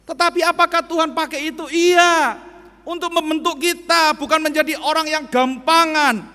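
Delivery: loud, high proclamation; preaching at full force. Acted. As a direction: drive the point home to the hall. Preaching to congregation.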